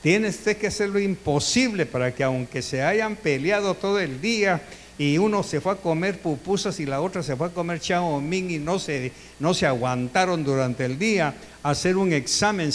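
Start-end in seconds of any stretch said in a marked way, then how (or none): none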